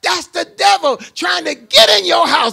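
high-pitched, building preacher's cry; forceful preaching. Acted. Preacher warning couples about the devil in the house, rising forceful preaching.

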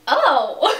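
shocked oh. Retold or imitated. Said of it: "she was just like".

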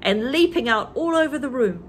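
plain reading voice

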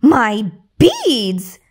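loud, sharp grab; a lightly voiced spoiled sister; indignant cry. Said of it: "cried one stepsister".

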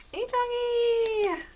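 drawn-out high-pitched squeal; sing-song high greeting, stretched long; high call. Real, not acted. Calling out fondly to a dog.

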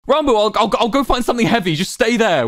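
very high, stammering desperation; panicked urgency. A narrator's reading. Wilbur desperate to rescue Ranbu, panicked urgency. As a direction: plead in a high panic, stumbling over the start.

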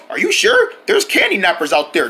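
ordinary talk, nothing marked

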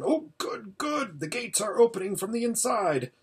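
very high, thrilled exclamations; delighted relief. A narrator's reading. Hermit sees the castle gates opening, delighted relief. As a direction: cheer on the turn in the battle.

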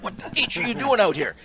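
mock angry coach. Coach chewing out the ref at the bench door, mock angry. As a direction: chew out the ref. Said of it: "he said".

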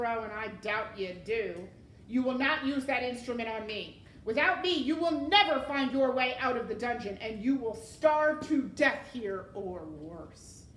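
loud villain tirade, slowing at the end; threatening tirade. A narrator's reading. Rat warning the armed serving girl, loud threat. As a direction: warn her forcefully, slowing on the threat.

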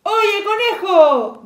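loud, high, drawn-out call from outside; hollering at the door; shouted call. A narrator's reading. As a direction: shout it slowly to someone behind a door. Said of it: "gritó alguien desde afuera".

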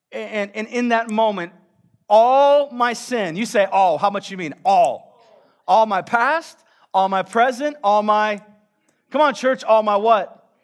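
loud, high, call-and-response preaching; full-force preaching. Acted. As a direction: drive it home to the church.

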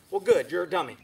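ordinary delivery

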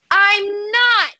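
high, drawn-out indignant cry; indignant denial. Acted. Ollie accused of flicking everyone, indignant denial. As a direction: protest innocence in a high-pitched outburst.

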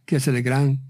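usual speaking voice

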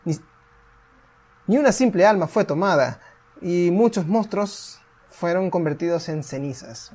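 lightly dramatic storyteller read; measured, solemn narrator; story narration. A narrator's reading. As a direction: tell the old war like a legend.

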